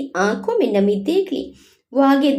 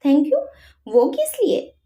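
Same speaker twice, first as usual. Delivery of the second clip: higher, puzzled echo of the thanks; 6 semitones higher than usual. A narrator's reading. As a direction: ask back, genuinely confused. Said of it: "जय ने असमंजस से पूछा". (उलझन भरा सवाल)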